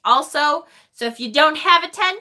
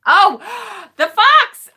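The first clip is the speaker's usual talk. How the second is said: much higher, louder sudden recognition; gasp, then say "the Fox" in thrilled surprise; delighted gasp; 9 semitones higher, 5 dB louder, slower than usual. Real, not acted. Host realizing the fox card suits Brad, in delighted surprise.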